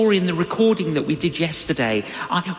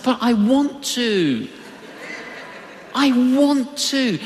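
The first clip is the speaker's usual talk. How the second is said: put-on higher, childlike insisting, drawn out; a hint of a sulky child begging; mock pleading; 7 semitones higher than usual. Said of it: "I said".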